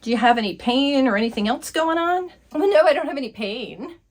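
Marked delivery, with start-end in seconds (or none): 2.5-4.0 put-on higher valley girl voice, very slow at the end; airy teen patient describing her racing heart; ditzy teen girl. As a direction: drag out the words so fast.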